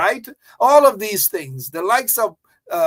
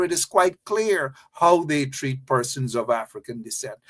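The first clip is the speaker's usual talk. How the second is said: normal talking voice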